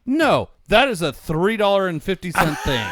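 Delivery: loud, high laughing protest; outraged shout. Real, not acted. Friend balks at the lobster roll price, laughing shout. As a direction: shout it in disbelief through a laugh.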